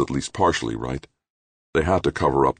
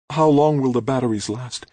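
slightly trembling; 11 semitones higher, 4 dB louder than usual. A narrator's reading. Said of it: "her voice trembling slightly".

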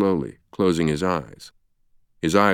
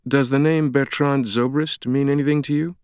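higher-pitched, careful opener; tentative venture; 8 semitones higher than usual. A narrator's reading. Sienna sounds out Ferris, venturing. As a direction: lead gently into a question. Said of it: "Sienna ventured".

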